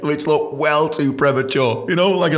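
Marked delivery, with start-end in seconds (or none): none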